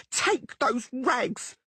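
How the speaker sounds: high, drawn-out scream. Acted. Blonde woman screams at Dandelion, throwing his things out. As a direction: hurl the words down at him, furious. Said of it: "the blonde woman screamed".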